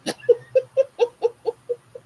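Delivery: real embarrassed high laugh; high burst of laughter. Real, not acted. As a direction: laugh at yourself, helpless. Embarrassing reveal of the cart.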